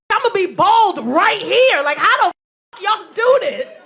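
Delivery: loud, desperate comic rant; exasperated outburst. Real, not acted. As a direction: plead in mock despair. Exasperated rant about her hair.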